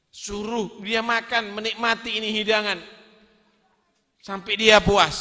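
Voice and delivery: loud, generous insistence; insistent husband. Acted. Husband telling wife to feed the beggar, loud and generous. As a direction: let him eat his fill, loudly.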